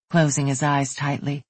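ordinary delivery, nothing marked

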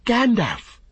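soaring high shout; wild, high-pitched cry. A narrator's reading. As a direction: call the name at the top of the voice. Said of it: "he cried".

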